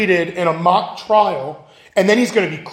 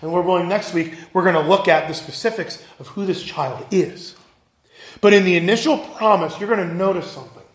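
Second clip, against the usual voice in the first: ordinary delivery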